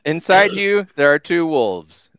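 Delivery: slow, solemn meme delivery; mock solemn. A host recites the two-wolves meme, slow and mock-solemn. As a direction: intone it like an old sage.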